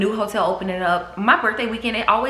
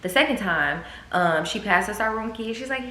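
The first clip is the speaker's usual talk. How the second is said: ordinary delivery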